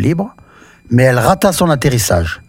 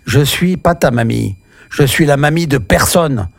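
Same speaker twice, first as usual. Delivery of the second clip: plain reading voice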